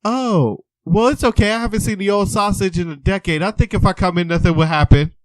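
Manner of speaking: high, loud flirty granny; cheeky old lady. Retold or imitated. Patient flirting with the man through the door, loud granny voice. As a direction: lay on the mischief. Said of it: "she's just like".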